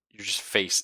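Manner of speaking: ordinary talk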